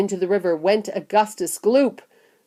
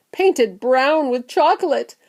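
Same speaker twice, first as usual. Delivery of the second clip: ordinary delivery, nothing marked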